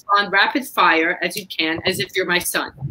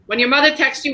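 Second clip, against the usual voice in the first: normal talking voice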